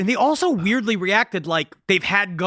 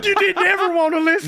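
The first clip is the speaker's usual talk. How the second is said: high, hammed-up tearful reproach; mock wounded wail; 8 semitones higher, faster than usual. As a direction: accuse them in a weepy whine. Acting Danny accusing his family.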